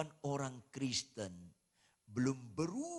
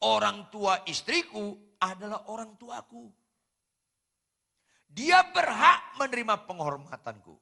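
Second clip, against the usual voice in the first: loud emphasis; 9 semitones higher, 14 dB louder than usual. Real, not acted. Preaching emphasis.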